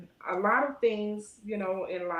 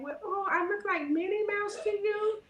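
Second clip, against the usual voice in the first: much higher, playful question; higher amused question to the little girl; mock disbelief; 12 semitones higher than usual. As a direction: tease the child gently. Asks child in disbelief.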